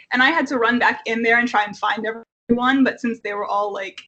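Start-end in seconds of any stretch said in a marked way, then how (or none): none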